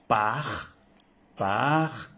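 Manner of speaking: paj said as French "pas", open A; French word demo; French pronunciation. Shown as a demonstration. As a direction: model paj for the students. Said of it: "se pronuncia igual".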